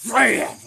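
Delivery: loud, high impact sound; explosive bam. Retold or imitated. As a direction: slam the word out suddenly. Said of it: "and I went".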